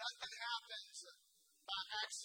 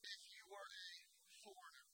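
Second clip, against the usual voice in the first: hushed whisper; 2 semitones lower, 11 dB quieter, faster than usual. Acted. Preacher whispering to the congregation about danger, hushed. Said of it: "warning it was not safe".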